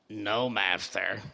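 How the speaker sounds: deep, slow servant grunt; a low, drawn-out Igor reply. Acted. Igor answers.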